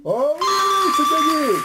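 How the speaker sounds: very high, loud cry of triumph; jubilant cheer. Real, not acted. Quiz host announcing the contestant has won, jubilant. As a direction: let the win explode, high and loud. Host celebrates the winning answer.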